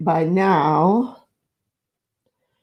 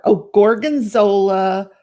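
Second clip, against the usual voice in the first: ordinary delivery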